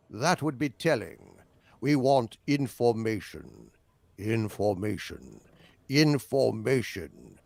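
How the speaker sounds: ominous, unhurried British villain; British accent. Acted. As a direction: draw it out in a measured British voice. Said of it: "like in the opening of The Prisoner".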